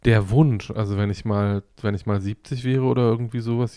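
usual speaking voice

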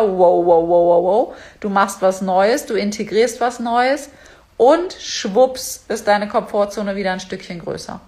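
usual speaking voice

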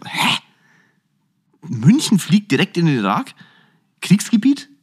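the inner voice can't believe it; incredulous inner voice. Retold or imitated. Inner voice at the barber, rising incredulous and loud. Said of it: "Und mein Kopf wieder so".

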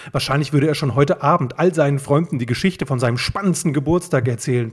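plain reading voice

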